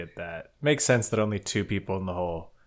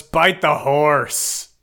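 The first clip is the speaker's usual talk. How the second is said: slow, louder deadpan order; slow firm insistence; 4 semitones higher, 6 dB louder, much slower than usual. Retold or imitated. Horde rat insists the recruit bite the horse, slow and firm. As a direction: repeat the order with no patience.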